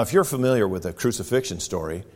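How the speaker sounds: ordinary delivery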